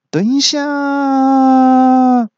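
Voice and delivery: 拉长高声大喊等一下; 慢慢拖长地喊住对方. A narrator's reading. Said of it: "再次有人大叫". (高声拖长大喊)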